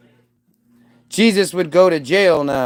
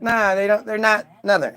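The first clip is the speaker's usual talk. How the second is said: normal talking voice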